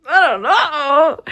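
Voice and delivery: loud, high, slow wail; overwhelmed wail. Real, not acted. Reader overwhelmed by the book, wailing loudly. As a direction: wail it helplessly, stretched out.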